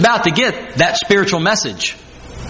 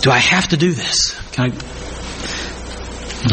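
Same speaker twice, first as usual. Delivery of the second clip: halting private prayer, fading away; speaking to himself; 4 semitones lower, 4 dB quieter, much slower than usual. Acted. Jesus speaking to himself before his hour, hesitant fading murmur. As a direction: think aloud, trailing to almost nothing. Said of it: "Jesus speaking to himself".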